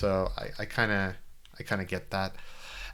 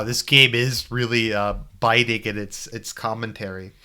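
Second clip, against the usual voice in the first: ordinary delivery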